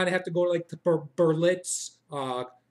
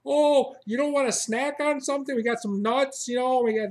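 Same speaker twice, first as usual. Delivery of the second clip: pushy barista; 7 semitones higher, 4 dB louder than usual. Retold or imitated. Barista offering snacks.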